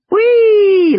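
high, loud squeal of glee; yelling. A narrator's reading. Lex rides the speeding raft down the river, yelling. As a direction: let out a thrilled, high-pitched whoop. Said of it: "Lex yelled".

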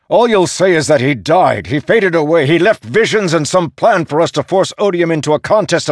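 high, loud, impassioned tirade; angry shouting. A narrator's reading. Dalinar railing at the storm god over Honor, shouting. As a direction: rail at him at full pitch. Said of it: "Dalinar shouted".

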